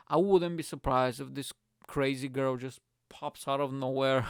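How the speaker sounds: normal talking voice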